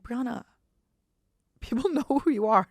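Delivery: a hint of excited friends; friends insisting. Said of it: "my friends kept being like".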